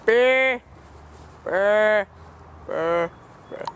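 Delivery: loud trilled barking brr. Pretend. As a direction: bark out a rolled brr. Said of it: "I was barking".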